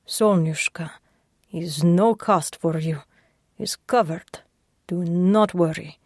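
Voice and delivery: put-on low, clipped Russian accent; gruff Russian accent. Sasha reassuring the injured patient, gruff and Russian. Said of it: "His voice rumbled low in his chest, gruff and clipped".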